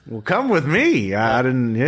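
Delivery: put-on a chuckling old junkyard prospector. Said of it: "I love it when our contact's an old prospector".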